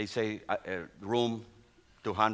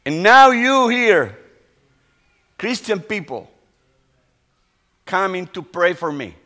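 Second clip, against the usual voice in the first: crying confession; 7 semitones higher, 12 dB louder than usual. Retold or imitated. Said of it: "And he was crying".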